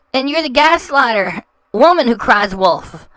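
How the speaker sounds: usual speaking voice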